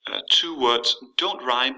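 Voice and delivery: ordinary delivery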